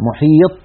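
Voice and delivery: "محيط" ending on a dead, unreleased ط; ط without qalqalah. Shown as a demonstration. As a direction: show the mistake in "محيط": no bounce on final ط. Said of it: "هكذا". (نطق "محيط" بطاء ساكنة بلا قلقلة)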